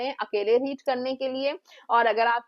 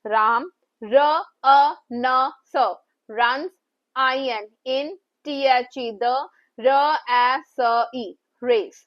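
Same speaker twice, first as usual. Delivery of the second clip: slow sounding out; 3 dB louder, much slower than usual. Shown as a demonstration. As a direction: sound out each letter slowly, then blend. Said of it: "यहाँ पर मैंने टू sentences लिखे हैं".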